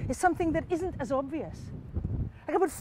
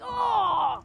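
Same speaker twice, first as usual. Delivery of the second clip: high, drawn-out "oh"; dismayed villagers; 6 semitones higher than usual. Acted. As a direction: groan high like a shocked crowd. Villagers see her hit.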